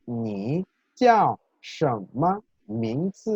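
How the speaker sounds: "Ni jiao shenme mingzi" very slowly, each word's tone drawn out; tone demonstration. Shown as a demonstration. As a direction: model "Ni jiao shenme mingzi" word by word, "jiao" falling sharply. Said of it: "sonaría por completo". ("Ni jiao shenme mingzi" muy lento, marcando cada tono)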